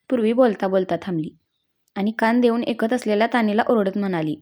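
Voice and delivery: usual speaking voice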